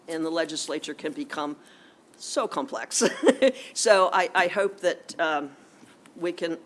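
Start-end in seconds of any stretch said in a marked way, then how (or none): 3.1-3.7 short bright laugh; burst of laughter. Real, not acted. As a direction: laugh at the irony. Laughs at complex legislature.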